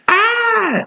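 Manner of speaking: high startled yelp; a sudden frightened yelp; startled cry. A narrator's reading. Carlos dodging the giant wolf, startled yelp. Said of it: "exclaimed Carlos".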